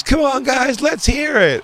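high-pitched hype-man call to the audience; crowd hype. Retold or imitated. Cubes hyping up the crowd.

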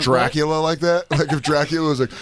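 ordinary delivery

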